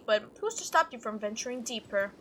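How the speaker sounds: ordinary talk, nothing marked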